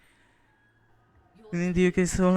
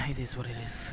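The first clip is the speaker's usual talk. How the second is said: whispered resignation; 4 semitones lower, 8 dB quieter than usual. Real, not acted.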